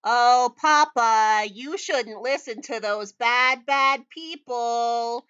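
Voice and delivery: loud, high sing-song daughter call; calling out sweetly. Acted. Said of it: "another voice call out".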